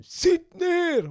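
high, loud curt order; barked order. Retold or imitated. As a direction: bark it like a stern officer. Officer ordering recruit to sit.

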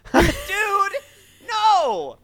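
Dude! No! shouted up high; yelping objection to a bad line; outraged protest. Real, not acted.

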